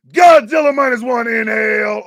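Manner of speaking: loud hyped-up yell; excited shout. Acted. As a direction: shout it out with big energy. Dramatic opening shout.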